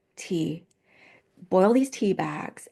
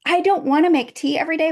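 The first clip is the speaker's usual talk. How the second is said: high, loud exasperated complaint; fed-up protest; 8 semitones higher, 6 dB louder than usual. Retold or imitated. Wife retelling her thought while making husband's tea, fed-up protest. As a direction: voice the inner complaint loudly, pitched up.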